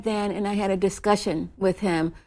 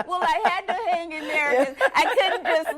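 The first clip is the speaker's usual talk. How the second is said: talking through laughter, higher pitched; laughing; 7 semitones higher than usual. Real, not acted. Guest admits she had to hang in there, laughing. Amused by own nerves onstage.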